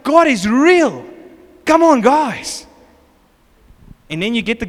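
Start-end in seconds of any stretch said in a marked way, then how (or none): 0.0-2.7 high, loud, slow exhortation; enthusiastic believer. Retold or imitated. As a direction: proclaim it slowly, rallying your friends. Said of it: "It's like".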